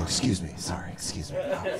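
soft, embarrassed mumbled excuse me; sheepish apology. Retold or imitated. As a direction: slip past people apologizing softly. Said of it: "You just go".

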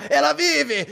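high, breaking cry of joy; mad scientist triumph. Acted. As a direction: shout it half laughing, overcome. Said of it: "Acabei me empolgando um pouco". (grito emocionado de cientista louco)

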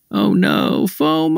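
high squeaky mock panic; mock dismay.

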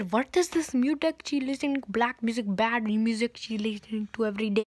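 put-on Indian-accented mother grumbling to herself; amateur Indian accent. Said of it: "my Indian accent is getting a".

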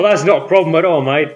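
hammed-up Cockney, veering Australian; Cockney accent. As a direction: put on a shaky-aim Cockney that lands near Australian. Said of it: "the Cockney accent".

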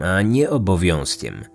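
ordinary delivery, nothing marked

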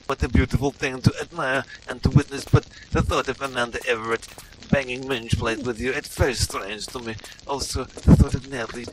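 put-on broken English in a heavy Russian-style accent; bewildered Russian-accented gamer; mock Eastern European accent.

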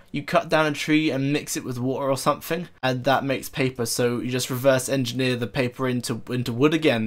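ordinary talk

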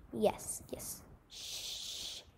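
unvoiced hush to calm the bird; hushing whisper. A narrator's reading. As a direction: whisper it, barely there. Hushing the pigeon.

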